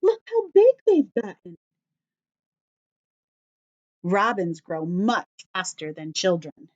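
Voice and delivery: ordinary talk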